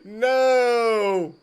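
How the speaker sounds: sharp high disbelieving no; shocked no. Real, not acted. Reacting to the hamster doping idea, shocked no. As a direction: blurt it out in mock shock.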